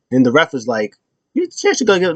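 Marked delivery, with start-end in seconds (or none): 1.3-2.2 mock referee warning. Ref voiced in retelling.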